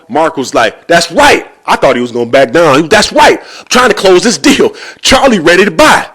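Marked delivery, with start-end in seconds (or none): 0.9-1.5 high, cocky, defiant retort; talking all tough. Retold or imitated. Seller answering the angry mortgage man, talking tough. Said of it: "Mark's talking all tough". 2.9-6.1 high, loud, defiant bluster; talking all tough. Retold or imitated. Seller pushing to close the deal, talking tough. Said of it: "Mark's talking all tough".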